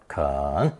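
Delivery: 肯 drawn out in a dipping third tone. Shown as a demonstration. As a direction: say 肯 low, dipping then rising, as kěn. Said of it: "also pronounced third tone".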